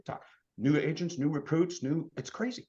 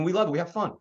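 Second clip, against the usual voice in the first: ordinary talk, nothing marked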